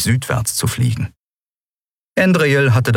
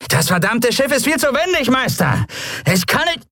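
desperate servant. Acted. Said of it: "Die Stimme seines Dieners".

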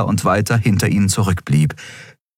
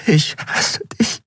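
slow, breaking whisper sliding higher; tearful whisper; 10 semitones higher, slower than usual. A narrator's reading. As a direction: whisper it on the verge of crying. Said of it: "Dann begann sie zu weinen".

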